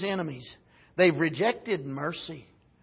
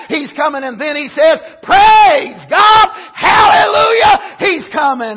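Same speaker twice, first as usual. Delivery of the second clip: loud, high, rising to a shout; jubilant preaching; 8 semitones higher, 10 dB louder than usual. Acted. Pastor proclaiming the Lord's coming to the saints, joyful shout. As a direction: cry out the good news.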